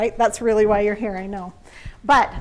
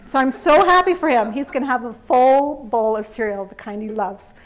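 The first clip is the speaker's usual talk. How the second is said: ordinary talk, nothing marked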